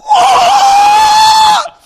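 high, loud martial arts yell laughing; kung fu shout. Retold or imitated. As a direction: let out a hammed-up karate cry. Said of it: "and goes".

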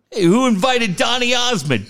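high heckling call; mock heckle. Buddy heckles the waiter's new vest, mock yell.

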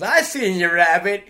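high, loud goofy yokel voice; Harvey gag voice. Retold or imitated. Retelling a movie joke to a friend, hammed-up yokel voice. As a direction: ham up the Roger Rabbit joke. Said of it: "And the guy goes".

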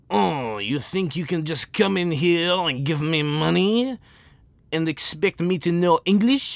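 put-on slow, incredulous French-accented complaint; mock French accent. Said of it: "the thing with, with France".

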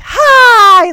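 loud, high, bursting greeting; a shop clerk's over-the-top hello; cheery shout. Retold or imitated. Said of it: "this very cheery saleswoman".

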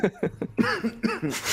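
sputtering coughing fit; choking cough. Real, not acted. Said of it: "I'm choking".

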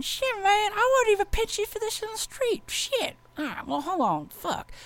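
squeaky cop cursing in surprise; high-pitched cop voice. Retold or imitated.

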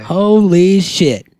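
slow drawn-out amazement; mock amazement. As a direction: stretch it out in fake awe. Exaggerated amazement at birthday.

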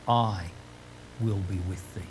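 soft, low and hushed, barely above a breath; still small voice. Shown as a demonstration. Preacher voicing God's still small voice, soft and hushed. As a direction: let it drop to a gentle hush like God's quiet voice. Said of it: "Think of the still small voice of God".

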